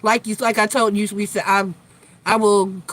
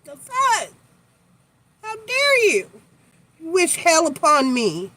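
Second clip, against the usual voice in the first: high theatrical offended outburst; mock outrage; 7 semitones higher than usual. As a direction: play the wounded diva, high.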